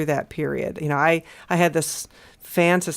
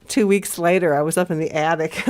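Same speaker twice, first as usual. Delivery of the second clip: usual speaking voice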